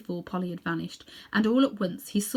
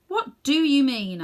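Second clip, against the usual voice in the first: put-on demanding boy; 7 semitones higher, 7 dB louder, slower than usual.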